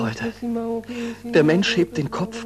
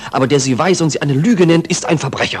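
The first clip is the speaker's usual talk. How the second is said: fervent outburst; 7 dB louder, faster than usual. Acted. Pupil defends teacher fervently.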